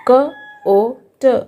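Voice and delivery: "क ओ ट" split into slow separate sounds. Shown as a demonstration. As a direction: stretch out क, ओ, ट one by one. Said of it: "कोट". (ध्वनियाँ धीरे-धीरे अलग करके)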